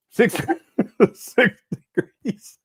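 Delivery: slow words broken up by real laughter; laughing. Real, not acted. Streamer cracking up at the chat joke, laughing through the words. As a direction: crack up while saying it.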